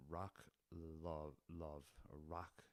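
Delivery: "rock... love" sounded out piece by piece; spelling pronunciation. Shown as a demonstration. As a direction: read rock and love as separate English words. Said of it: "'cause it's spelt".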